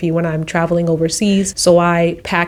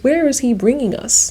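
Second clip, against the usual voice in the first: normal talking voice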